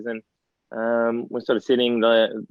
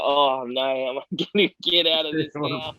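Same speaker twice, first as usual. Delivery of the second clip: laughing disbelief; 3 semitones higher than usual. Retold or imitated. Retelling the coach's reply to the host, half-laughing. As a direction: chuckle through the trapped feeling. Retold panic at coach's approval.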